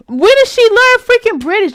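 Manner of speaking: high-pitched, incredulous outburst; exasperated, high-pitched disbelief. Real, not acted. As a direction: demand it in disbelief, pitch shooting way up. Mock-outraged rant about the accent.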